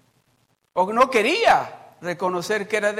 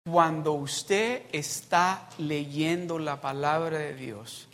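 ordinary delivery

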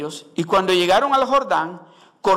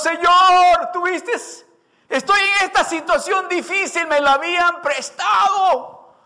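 desperate shout to God; 9 semitones higher than usual. Acted. Woodcutter shouting to God about the borrowed axe, desperate. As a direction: cry out to God in distress.

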